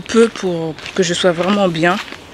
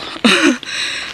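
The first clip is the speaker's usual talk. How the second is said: petit rire; 8 semitones higher, 3 dB quieter than usual. Real, not acted. Laughs at her own complaint.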